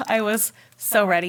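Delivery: usual speaking voice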